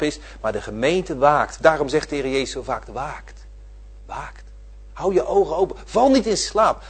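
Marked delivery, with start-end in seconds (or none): 2.9-4.4 soft urging. Acted. Urging the church to keep watch.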